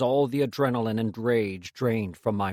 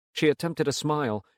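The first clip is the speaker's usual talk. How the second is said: usual speaking voice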